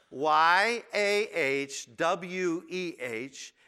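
Y A H W E H read slowly, each letter on its own; spelled out letter by letter. Shown as a demonstration. Preacher spells the name with added vowels, slowly. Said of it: "spelled like this".

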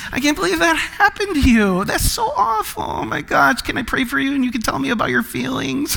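high, soft, syrupy mock compassion; gushing sympathizer. Offering to pray for the pizza victim, gushing mock sympathy. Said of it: "you have kindness, you got mercy".

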